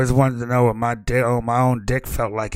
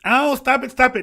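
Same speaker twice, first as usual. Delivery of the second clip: flustered protest; 11 semitones higher, 3 dB louder than usual. Acted. NPC struggling.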